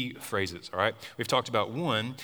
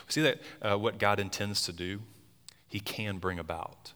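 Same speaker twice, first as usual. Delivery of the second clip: ordinary talk, nothing marked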